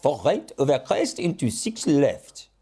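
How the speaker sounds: slow, hammed-up French accent. Jokey audition as a French rally co-driver, in a slow French accent. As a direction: put on a comic French accent, taking your time. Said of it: "No, no, no, a French accent".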